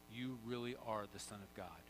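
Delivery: low, soft, awed quote; quiet, understated reverence; hushed worshipful exclamation. Retold or imitated. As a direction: say it softly in worship. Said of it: "they exclaimed".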